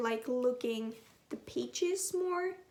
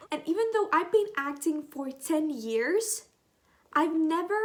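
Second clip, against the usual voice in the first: ordinary delivery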